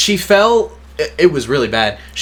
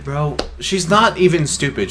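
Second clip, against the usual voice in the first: ordinary delivery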